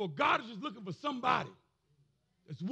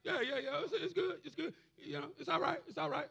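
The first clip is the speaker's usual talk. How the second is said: high, light, easygoing reassurance; imitating a forgiving God, casually soothing; reassuring God; 7 semitones higher than usual. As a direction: wave it all away in quick little repeats. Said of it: "God's like".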